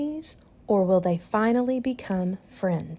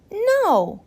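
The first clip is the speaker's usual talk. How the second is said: put-on high, firm child's no; a small boy refusing; little boy's no; 10 semitones higher, 4 dB louder than usual. A narrator's reading. Owen refuses his parents, high and firm like a boy.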